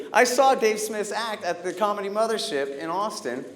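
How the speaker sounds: ordinary talk, nothing marked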